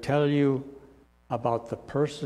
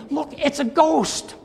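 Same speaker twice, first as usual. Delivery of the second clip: high, mock-terrified cry of "Look, it's a ghost!"; terrified scream; 5 dB louder than usual. Preacher acting the frightened disciples in the storm, high mock scream. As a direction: play the disciples shrieking in fright, pitched way up. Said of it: "they start screaming".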